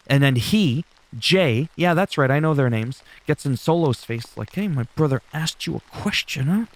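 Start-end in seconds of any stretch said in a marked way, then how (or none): 4.5-6.6 a hint of a menacing twin brother, put on badly; lightly voiced street tough leaning in; cringy tough-guy impression. Jay squaring up to Solo, mock-menacing and cringy. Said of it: "it didn't quite sound like that".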